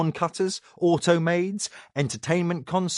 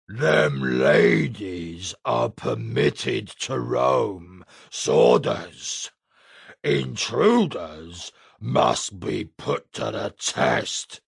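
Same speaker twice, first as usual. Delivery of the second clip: much lower, slow gruff giant; deep giant voice; 5 semitones lower, much slower than usual. A narrator's reading. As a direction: grind it out like a stubborn ogre. The giant speaking.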